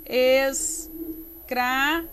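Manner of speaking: ES, CRA, VA split apart slowly; slow, even syllable splitting. Shown as a demonstration. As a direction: scan ESCRAVA in three separate pieces. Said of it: "Como é que eu separo?".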